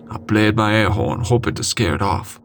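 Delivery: plain reading voice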